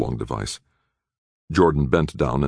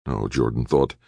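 ordinary delivery, nothing marked

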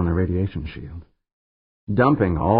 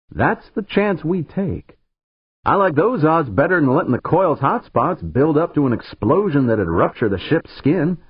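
firm and raised; 10 semitones higher, 5 dB louder than usual. A narrator's reading. Pancho insisting on the plan.

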